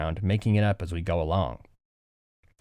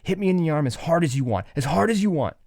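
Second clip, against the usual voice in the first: high, pleading mock apology; guilty kid apologizing; 6 semitones higher than usual. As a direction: frantically make amends like a kid on the playground. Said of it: "so the guilty party goes".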